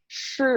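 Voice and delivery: shi with a quick falling tone; fourth tone. Shown as a demonstration. Teacher demonstrating the second syllable, shi in a falling tone. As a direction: drop shi sharply in fourth tone. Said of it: "y la segunda en cuarto".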